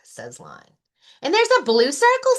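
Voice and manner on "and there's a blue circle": high, loud, fast bunny voice; excited Rabbit. Acted. Rabbit guessing about the map to Lion, high and fast. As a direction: blurt it out breathlessly.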